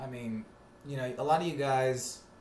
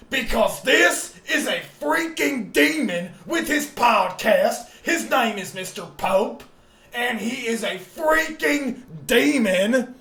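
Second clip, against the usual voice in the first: much higher, louder, slow raving; raving rant; 10 semitones higher, 12 dB louder, much slower than usual. Acted. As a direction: rant like a raving radio host. Alex Jones style rant.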